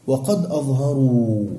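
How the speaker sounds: ordinary talk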